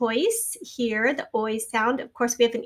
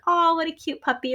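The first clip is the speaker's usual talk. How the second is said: higher-pitched coo over a puppy; 6 semitones higher than usual. Acted. Teacher acting out the aw sound over a puppy, cooing. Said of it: "I always think of this as like".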